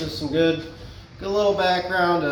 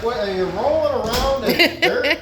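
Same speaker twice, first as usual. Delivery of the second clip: teasing question through a laugh; 6 semitones higher, 4 dB louder than usual. Real, not acted. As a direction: laugh while you tease. Teasing about the dirty door.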